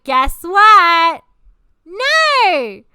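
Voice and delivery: slow, loud mocking build to a high No; sarcastic guess-what setup; sarcastic reveal. Real, not acted. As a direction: drag it out, then spring the punchline.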